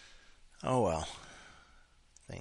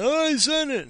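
mock sender voice; 8 dB louder than usual. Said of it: "And it's like".

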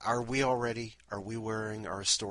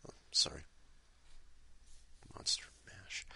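whispered aside; whispering; 7 dB quieter than usual. Real, not acted. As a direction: whisper it under the breath.

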